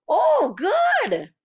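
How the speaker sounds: put-on high, loud little-kid cheer; a small child thrilled with his food; gleeful toddler shout. A narrator's reading. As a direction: squeal it like a delighted toddler. Toddler Fudge cheering the omelet.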